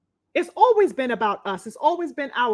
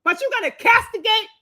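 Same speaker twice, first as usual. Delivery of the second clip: pitched up and fired up, hammering each charge; heated rant; 7 semitones higher than usual. Real, not acted. Streamer ranting to viewers about the press berating [name], heated and raised. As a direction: vent your outrage at the hypocrisy.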